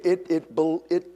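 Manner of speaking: normal talking voice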